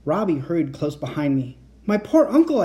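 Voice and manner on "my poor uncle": mildly breathless, higher and louder; a touch of breathless worry, pitched up. A narrator's reading. Kat finding the room where uncle is trapped, breathless. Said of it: "I said breathlessly".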